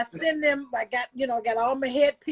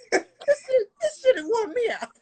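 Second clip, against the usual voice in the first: laughing; 9 semitones higher than usual. Real, not acted. Exhausted mom complaining, laughing.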